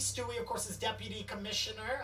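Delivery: a touch of a proud cousin making introductions; introducing the family. Acted. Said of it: "He goes".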